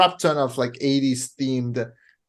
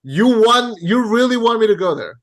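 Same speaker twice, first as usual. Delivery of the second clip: heated challenge; 7 semitones higher, 4 dB louder than usual. Real, not acted. Heated movie argument.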